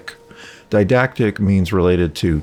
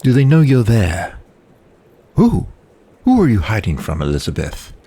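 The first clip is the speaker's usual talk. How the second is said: put-on generic British accent; 2 semitones higher than usual. Brother questioning his hiding sister, hammed-up British accent. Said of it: "generic, generic British accent".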